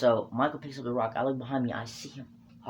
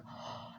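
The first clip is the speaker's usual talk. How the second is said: short unvoiced gasp; 16 dB quieter than usual. Seeing the rock land.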